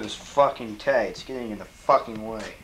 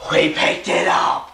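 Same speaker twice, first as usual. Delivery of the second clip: triumphant cheer; 3 semitones higher, 5 dB louder than usual. Acted. Triumph over picking food.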